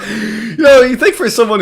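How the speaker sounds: laughing. Real, not acted. Laughing at the reply.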